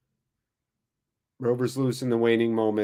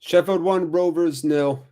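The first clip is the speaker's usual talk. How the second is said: high, loud burst calling the score; excited score call; 8 semitones higher, 5 dB louder than usual. Real, not acted. Fan calling the score after the goal, excited and loud. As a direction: shout the scoreline like a commentator.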